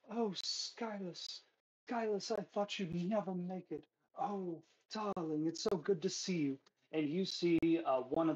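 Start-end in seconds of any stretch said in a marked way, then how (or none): 0.1-6.6 Alda Arkin. Acted. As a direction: gush over a lover, pitched up. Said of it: "Alda Arkin, uh, go".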